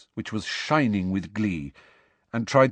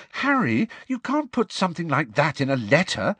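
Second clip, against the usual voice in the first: alarmed; 8 semitones higher than usual. Acted. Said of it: "looking alarmed".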